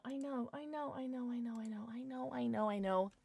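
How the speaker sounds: hushed, repetitive murmur of apology; very soft, sympathetic patter; soft placating. Real, not acted. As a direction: soothe grumbling listeners under your breath. Soothing viewers about the ad.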